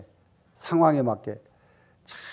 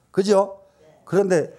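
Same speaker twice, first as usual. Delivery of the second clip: ordinary talk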